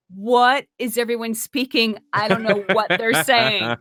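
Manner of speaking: loud, high, drawn-out mock panic; bewildered outburst. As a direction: act out total bafflement at full volume. Acting out bewilderment in Montreal.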